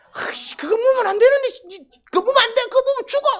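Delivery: high, loud, panicked warning; frantic parent cry. Acted. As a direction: cry out as a parent watching their child eat poison. Parent sees child eating poison.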